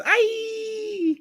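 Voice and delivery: very high, sustained falsetto squeal; drawn-out mock child shriek; imitated frustrated child's squeal. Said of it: "The kid goes".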